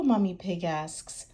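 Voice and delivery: plain reading voice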